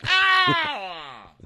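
high loud cartoon cry; mock yelp of pain. Tyrion crying out when Bronn hits him. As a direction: yelp as if punched.

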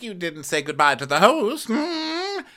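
high put-on scolding with a hum; mock Karen. Karen scolding Giselle about the host, mock prim. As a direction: exaggerate a prim reproach. Acted quarrel, Karen's part.

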